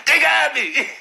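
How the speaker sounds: high, cracking "They got me" through laughter; mock wounded whimper. Retelling the bloodied man, high laughing whimper.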